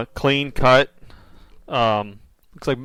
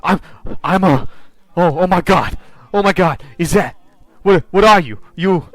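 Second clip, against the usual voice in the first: raised, stammering alarm; acted panic, higher and louder; frantic, disoriented; 5 semitones higher, 5 dB louder than usual. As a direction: blurt it in broken starts while struggling to rise. Voicing strapped man struggling to get up.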